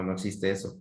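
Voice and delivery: ordinary talk, nothing marked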